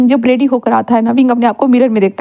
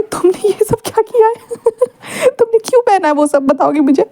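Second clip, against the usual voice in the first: much higher, giggly teasing; laughing; 8 semitones higher than usual. A narrator's reading. Wangji teasing Wing about his outfit, high giggles. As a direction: laugh out the question. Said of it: "वांगजी हंसते हुए ये सारी चीज़ें कर रहा था".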